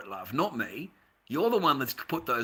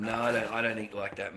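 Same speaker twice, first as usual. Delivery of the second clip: usual speaking voice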